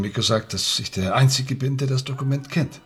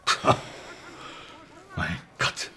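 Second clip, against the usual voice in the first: exasperated breathy sigh; 8 semitones lower, 6 dB quieter, slower than usual. Acted. Coach sighs in exasperation.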